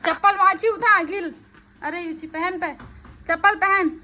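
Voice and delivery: loud, high-pitched bossy call; shouted orders. Real, not acted. Mother tells child to put slippers on, loud ordering. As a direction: shout instructions across the yard.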